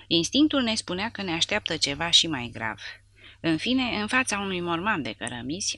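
plain reading voice

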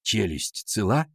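low, slow careful query; teacher's concerned question. A narrator's reading. Teacher asks Лёшка about his jaw, concerned question. Shaken teacher checks on boy.

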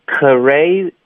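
CRA split as "kuh-ray", k first and lips moving after; separated K. Shown as a demonstration. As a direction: break cra into two syllables. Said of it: "就不太对". (把 cra 错读成 ke-ray)

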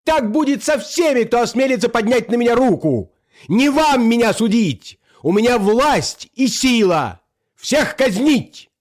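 high-pitched tyrant's tirade; frenzied usurper threatening everyone; enraged shouting. A narrator's reading. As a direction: rant high, stretching the last commands. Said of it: "закричал в бешенстве узурпатор".

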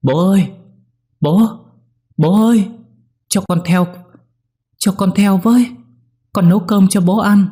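put-on high, loud screaming child in tears; crying scream. A narrator's reading. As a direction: scream after him, sobbing and desperate. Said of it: "khóc chạy dọc sông kêu thét lên". (gào thét trong nước mắt, rất cao và to)

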